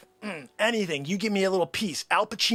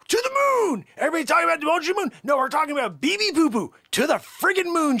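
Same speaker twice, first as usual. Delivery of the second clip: hyped sales pitch; 5 semitones higher than usual. Acted. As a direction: sell it like a hyped broker yelling.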